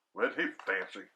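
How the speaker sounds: ordinary delivery, nothing marked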